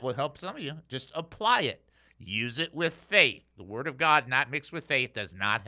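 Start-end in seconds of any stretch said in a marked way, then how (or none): none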